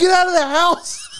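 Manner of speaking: very high, loud, slow shriek; mock screaming grandma. Grandma throwing the boys out, mock screaming. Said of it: "Zach's grandma's screaming".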